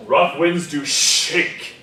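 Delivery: "shake" doubled and stressed, said slowly and loud; doubling on shake. Shown as a demonstration. Teacher showing expressive doubling on a word. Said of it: "'cause we can do".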